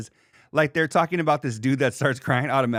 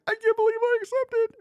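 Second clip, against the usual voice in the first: falsetto mock acceptance, far higher; mocking fiancée. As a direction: squeal it like a giddy dork. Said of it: "And then I'm like".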